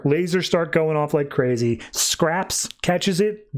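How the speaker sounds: ordinary talk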